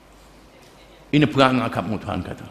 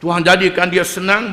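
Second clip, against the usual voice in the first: ordinary talk, nothing marked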